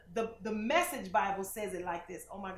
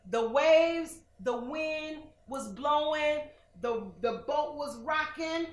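higher, louder, slow storm telling; dramatic storm telling; 5 semitones higher, 7 dB louder, slower than usual. Acted. Preacher painting the storm for Austin, building.

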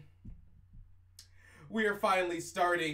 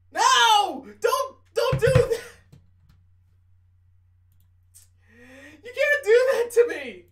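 much higher and louder, pleading shout; loud protest; 13 dB louder than usual. Real, not acted. Streamer yelling at the game not to do that, loud and high. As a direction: yell at the screen to stop it.

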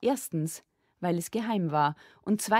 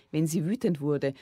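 ordinary delivery

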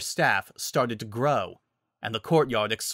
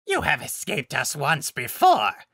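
higher, put-on monster voice; beastly villain voice, raised in pitch; menacing werewolf alpha; 4 semitones higher than usual. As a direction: threaten like the pack leader.

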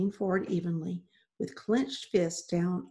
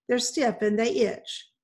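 mildly grumbling husband; 3 semitones higher, 3 dB louder than usual. A narrator's reading. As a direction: complain grudgingly about the shirts. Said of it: "he grumbled".

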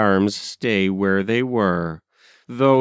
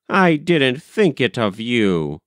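ordinary delivery, nothing marked

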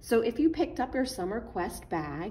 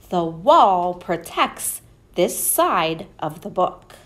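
loud, slow, expressive storybook read; reading to children; 10 dB louder, slower than usual. Acted. Reading the book to the children, loud and expressive. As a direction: perform it big for the kids. Reads picture book aloud.

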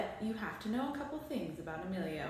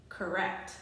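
usual speaking voice

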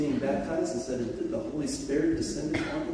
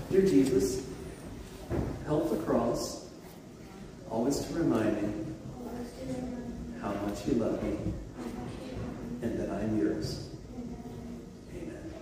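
prayer to repeat after; 4 dB quieter than usual. Real, not acted. Leads children in a prayer.

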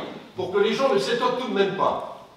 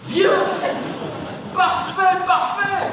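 very high, exclaimed praise; delighted approval; 9 semitones higher, slower than usual. Acted. Coach approving the pupil's short answer, delighted.

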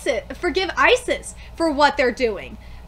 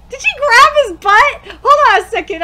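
excited shout; 6 semitones higher, 10 dB louder than usual. Real, not acted. Shocked reaction to scene.

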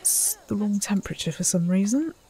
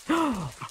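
delighted gasp; 4 semitones higher, 6 dB quieter than usual. Real, not acted. Delight at wolf in sprinkler.

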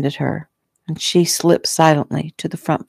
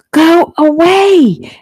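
high, loud, drawn-out scream; child's scream; 12 semitones higher, 6 dB louder, much slower than usual. A narrator's reading. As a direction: scream it slowly at the top of your voice. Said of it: "Jeffy screamed".

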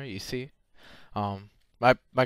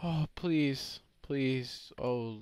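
ordinary talk, nothing marked

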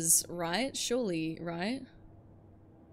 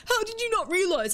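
high, louder mock demand; incredulous angry teammate; mock outrage; 12 semitones higher, 5 dB louder than usual. Teammate demanding an answer, mock outrage. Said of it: "And he's like".

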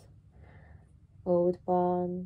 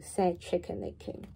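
ordinary delivery

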